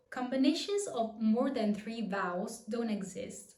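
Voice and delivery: ordinary talk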